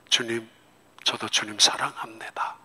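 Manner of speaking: soft, unvoiced whisper; whispering love to the Lord; whispered prayer. Real, not acted. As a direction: breathe it like a private prayer.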